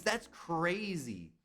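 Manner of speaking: stunned. Real, not acted. Slow stunned repeat.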